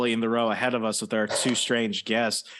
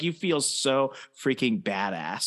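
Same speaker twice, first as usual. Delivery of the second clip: ordinary delivery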